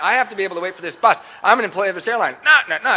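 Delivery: worked-up retold scolding; really mad. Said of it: "like, really mad at me".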